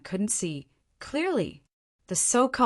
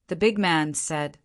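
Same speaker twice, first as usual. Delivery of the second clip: ordinary delivery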